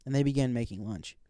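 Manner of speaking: ordinary delivery, nothing marked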